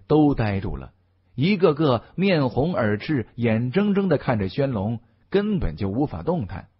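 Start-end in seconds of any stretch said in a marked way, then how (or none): none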